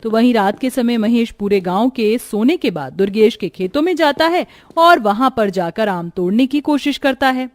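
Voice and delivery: ordinary delivery, nothing marked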